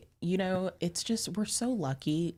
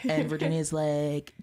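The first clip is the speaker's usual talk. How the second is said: ordinary talk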